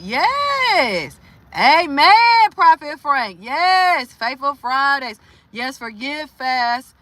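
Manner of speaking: high loud amen and yes; excited agreement. Real, not acted. Answering supportive comments from chat, excited and loud. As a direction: hype the chat up.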